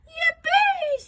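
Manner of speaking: very high, loud whoop; gleeful shout. A narrator's reading. Boy sure he has found the Yeti, shouting yippee. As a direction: squeal it out with glee. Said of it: "shouted George".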